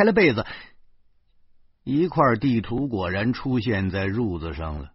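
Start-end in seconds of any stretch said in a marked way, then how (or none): none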